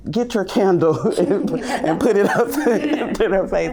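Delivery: imitating a toddler through giggles; laughing while quoting. Teacher quoting a toddler while laughing. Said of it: "and he'll say".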